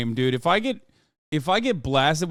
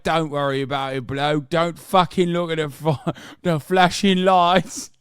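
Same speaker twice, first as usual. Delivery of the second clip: loud, exaggerated British accent, words stretched out; hammed-up British reassurance, getting higher and louder; mock British officer; 5 semitones higher, 6 dB louder than usual. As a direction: bellow it like a cartoon Brit. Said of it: "They were like".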